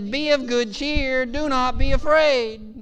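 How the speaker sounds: high, loud, slow proclamation; proclaimed command. Retold or imitated. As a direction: ring it out over the storm. Sermon climax, Jesus's words proclaimed.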